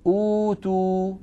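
أوتوا with the first uu drawn out slowly; recited madd. Shown as a demonstration. Teacher recites example word of madd al-badal. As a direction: stretch the uu of أوتوا for two counts. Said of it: "في كلمة أوتوا جاء قبلها همزة".

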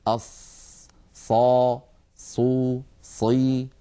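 أص as an unvoiced hiss, then صا, صو, صي each with a clear emphatic ṣ; syllable drill of ṣād: sukūn, fatḥa, ḍamma, kasra; ṣād with each vowel. Shown as a demonstration. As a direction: run through أص, صا, صو, صي one by one. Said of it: "وهي صفة مرت معنا سابقا".

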